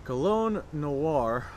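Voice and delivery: Cologne Noir drawn out very slowly; butchered name. Shown as a demonstration. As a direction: say Cologne Noir slow and unsure. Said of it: "I apologize if I butchered that name".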